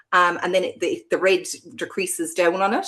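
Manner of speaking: usual speaking voice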